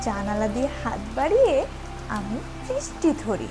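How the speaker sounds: plain reading voice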